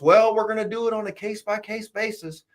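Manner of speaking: high, evasive official parody; mocking officials. Quoting the hedging people in Trump's orbit, high mock voice. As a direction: dodge it in a high prim bureaucrat voice.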